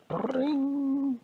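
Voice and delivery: very high sustained ringing zap; high magical "pring"; magic spell zing. Acted. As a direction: trill a long, piercing spell noise. Magic spell sound imitated.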